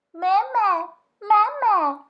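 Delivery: high, loud chick voice calling; a baby goose crying for its mother; peeping gosling. A narrator's reading. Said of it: "said the egg".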